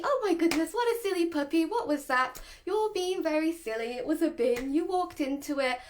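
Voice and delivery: light singsong fussing over a silly puppy; over-the-top cheery, like kids' TV; upbeat puppy talk. Shown as a demonstration. Owner to puppy who hit a bin, upbeat. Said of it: "because this upbeat".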